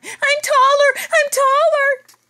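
very high, loud, stretched-out squeal; kid's squeal. Acted. Stretched kid shrieking to the nurse, high loud squeal.

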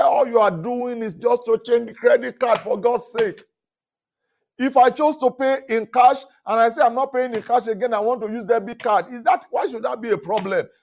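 a guest venting at hotel staff over a card change, heated; heated protest. Retold or imitated. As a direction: let the frustration build, high and loud. Retold argument with supervisor.

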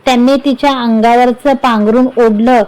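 usual speaking voice

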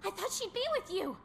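high, worried voice; anxious urgency. Acted. Companion worried about a missing friend, high anxious urgency. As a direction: fret urgently over someone missing.

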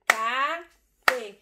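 "abacate" slow, a... ba... ca... te; syllable by syllable. Shown as a demonstration. Teacher counting four syllables with the class, slow and separated. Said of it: "eu tenho quatro sílabas".

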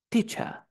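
"Teacher" acabado en schwa, R muda; teacher sin R. Shown as a demonstration. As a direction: repite "Teacher" con final vocálico. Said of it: "¿La R se pronuncia?". ("Teacher" a la británica, sin R)

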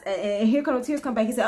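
ordinary talk, nothing marked

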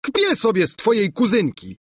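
high-pitched, defiant sneer; acted quarrelsome outburst; scornful retort. A narrator's reading. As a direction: fling contempt back at the rival.

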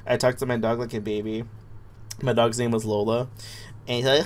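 ordinary delivery

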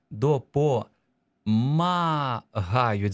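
very slow syllables, vowel drawn out; stretched dictation. Shown as a demonstration. Teacher pointing out the vowel in допомагають, drawn-out dictation.

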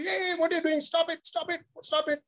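high, sharp mock scold at the puppy. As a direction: snap at a puppy mid-act, pitched high. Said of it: "You scold the puppy".

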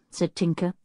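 plain reading voice

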